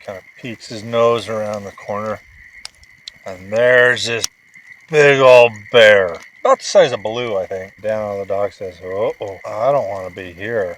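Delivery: put-on telling a bedtime story to a sleepy child; bedtime story narration. A narrator's reading. Dad reading a bedtime story to his son, animated storytelling.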